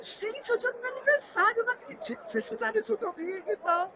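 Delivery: queixa aguda, sem gritar; indignação recontada. Retold or imitated. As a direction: reencene a mágoa brava em voz alta de tom, baixa de volume. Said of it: "eu tava brava pra caramba". (queixa indignada e aguda, sem grito)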